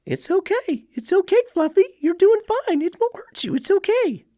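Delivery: very high, cooing reassurance; soothing scared dog. Retold or imitated. Owner soothing a scared dog in high baby talk. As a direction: coo it's okay to a frightened puppy. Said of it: "And how do you say it?".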